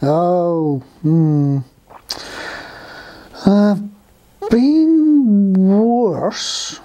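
long, drawn-out words with big pauses; laboured, sluggish storybook creature; slow snail. Acted. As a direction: stretch every word like a ponderous snail. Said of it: "said Slow".

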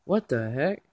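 ordinary delivery

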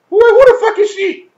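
high, loud, incredulous; who on earth is this, demanded; shocked high-pitched outburst; outraged disbelief. Real, not acted. Outraged at a random character.

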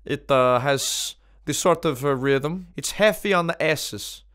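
lightly voiced French accent, hesitant; a Frenchman savouring camembert, in French accent. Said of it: "of Montpellier".

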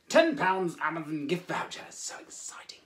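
hushed, breathy whisper of excitement; excited whisper. Acted. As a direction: whisper it like a thrilling secret. Builds excitement about the prize.

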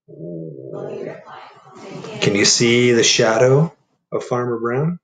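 plain reading voice

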